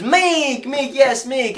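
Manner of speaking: high, loud, excited mock Chinese accent; imitated Chinese caller. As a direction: insist shrilly in a Chinese accent. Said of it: "He goes".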